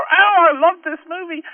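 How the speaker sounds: high, loud gushing fan; mock-enthusiastic, high-pitched and loud; gushing moviegoer. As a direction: gush like an easily pleased moviegoer. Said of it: "anyone can say".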